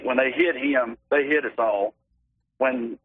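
ordinary delivery

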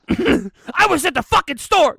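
high, loud, slow rant voice. Acted. Host joining in an impression of an absent person, ranting.

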